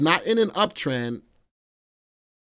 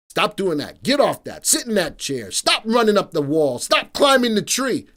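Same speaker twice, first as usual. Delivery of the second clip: mock scolding, building louder and higher; exasperated grandpa commands, rising; 5 semitones higher, 3 dB louder than usual. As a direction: rattle off orders at unruly grandkids.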